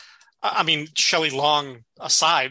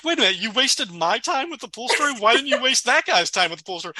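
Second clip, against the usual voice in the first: a higher-pitched, mock-irritated Frasier; Frasier exasperated; 8 semitones higher than usual. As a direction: play the fed-up Frasier, a bit higher. Frasier's irritation, retold by the podcaster.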